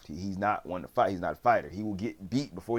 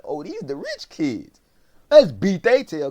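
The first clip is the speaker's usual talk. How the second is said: high, building to a loud call; mock bully eagerness; 9 semitones higher, 11 dB louder, slower than usual. New bullies spotting the rich kids, loud eager call. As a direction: imitate a bully rallying his gang.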